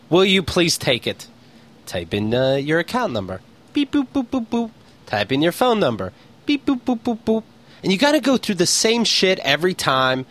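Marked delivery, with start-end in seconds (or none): 3.7-4.7 high beeping noises; keypad beeps. Retold or imitated. Imitating phone keypad beeps. 6.5-7.4 high beeping noises; keypad beeps. Retold or imitated. Imitating phone keypad beeps.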